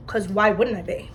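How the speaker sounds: normal talking voice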